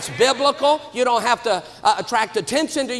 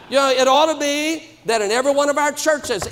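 ordinary talk

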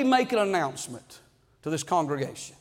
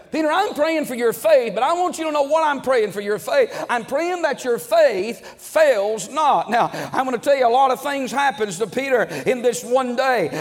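fervent preaching; 3 semitones higher than usual. Acted. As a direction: raise the voice and drive the point home to the church. Preaching builds up.

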